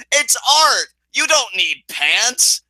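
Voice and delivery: high, emphatic retold punchline, drawn out. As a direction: imitate a showman proclaiming it, stretching the end. Quoting [name]'s best line with relish.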